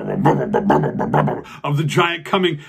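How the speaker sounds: ordinary delivery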